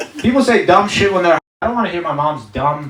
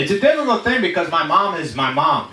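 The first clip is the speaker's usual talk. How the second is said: ordinary talk, nothing marked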